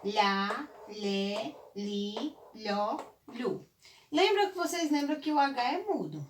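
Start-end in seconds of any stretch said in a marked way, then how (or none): 0.0-3.6 LH syllables held one at a time, rising slightly; syllable drill. Shown as a demonstration. Teacher shows how the LH family works, syllable drill. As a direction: sound out each syllable separately. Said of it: "como funcionaria a família do olhar".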